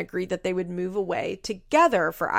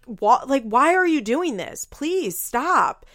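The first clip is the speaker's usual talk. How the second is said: high, louder imitated pleading; retold distress, pitched up; exasperated plea; 6 semitones higher, 5 dB louder, slower than usual. Acts out Kale begging Javi.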